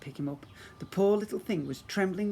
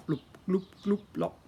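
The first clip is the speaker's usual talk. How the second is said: bubbling lava noises; 4 semitones higher than usual. Acted. Imitating lava sounds.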